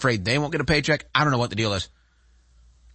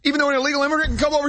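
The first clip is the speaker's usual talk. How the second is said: sarcastic; 9 semitones higher than usual. Pretend. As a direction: ham up fake panic over the DMV. Sarcastic mock outrage.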